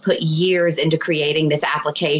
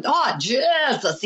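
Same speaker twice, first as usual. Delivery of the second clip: high, drawn-out mock Irish exclamation; 7 semitones higher, much slower than usual. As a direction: stretch it out in a playful Irish accent. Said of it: "They're always making a voice".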